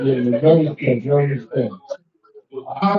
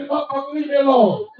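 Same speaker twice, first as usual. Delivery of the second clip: telling off; 8 semitones higher than usual. Retold or imitated.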